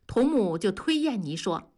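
ordinary delivery

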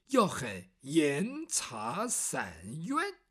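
drawn out word by word, low; slow, deliberate coaching. Acted. Bai Yutang teaches Yumo what to cry out, slowly and deliberately. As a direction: spell it out slowly so it is remembered. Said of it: "你要记住，要喊严查散冤".

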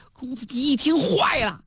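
ordinary delivery

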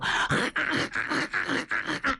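booming character laugh from the chest; hearty laugh. Acted. General strides in with a hearty laugh at his catch. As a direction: let out a big triumphant laugh. Niu Gao enters laughing.

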